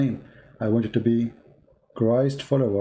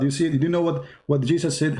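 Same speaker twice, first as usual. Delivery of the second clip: normal talking voice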